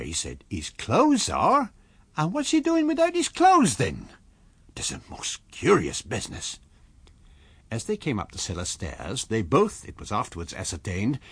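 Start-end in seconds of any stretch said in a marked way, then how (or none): 0.5-6.6 puzzled rustic landlord. Acted. Hall puzzled over empty room.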